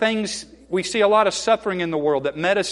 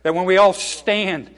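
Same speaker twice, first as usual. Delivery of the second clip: prayer; 2 semitones higher, 4 dB louder than usual. Real, not acted. Leads a prayer.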